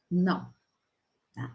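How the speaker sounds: ordinary delivery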